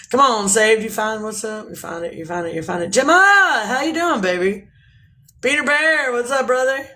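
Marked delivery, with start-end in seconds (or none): 2.9-4.7 high, loud sing-song hello; greeting a viewer by name, loud and high; playful greeting. Acted. 5.4-6.9 playful greeting. Acted.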